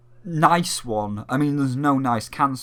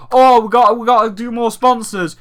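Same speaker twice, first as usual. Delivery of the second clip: higher, louder breezy host voice; mock hurried host; 6 semitones higher, 8 dB louder than usual. Mocking the hosts hurrying to the ads, higher and louder. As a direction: rush on to the sponsors brightly. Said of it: "you're too busy like".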